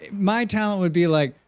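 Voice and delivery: ordinary talk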